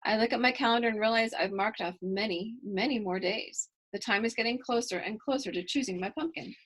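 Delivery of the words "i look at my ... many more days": plain reading voice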